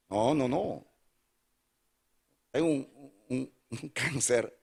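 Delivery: ordinary talk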